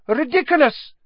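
high, loud incantation; loud cry. A narrator's reading. Pupil casting at the bandaged mummy, crying out. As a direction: cry out the spell with force. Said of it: "cried Parvati".

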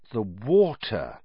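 slow, thoughtful reveal; slow and pondering; slowly. Acted. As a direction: let the words come slowly as it dawns. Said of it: "he said slowly".

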